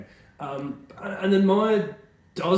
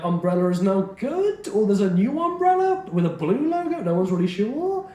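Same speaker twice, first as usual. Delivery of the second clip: uptalk; 6 semitones higher than usual. Real, not acted. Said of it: "saying this in, like, a weird tone of voice".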